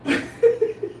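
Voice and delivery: a high, airy burst of laughter; high breathless laugh. Real, not acted. Host cracking up after the mock rant, breathy laugh.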